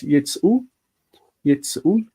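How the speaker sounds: yitzu in two syllables, no vowel between ts and u; unpronounced vocal shva. Shown as a demonstration. Teacher showing everyday reading, yitzu with the shva left silent. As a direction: say yitzu as yeet-SOO, dropping the shva. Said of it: "nobody really pronounces the vocal shva".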